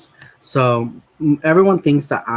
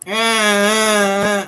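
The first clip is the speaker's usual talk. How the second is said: groggy groan; 8 semitones higher than usual. Retold or imitated. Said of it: "I'm like".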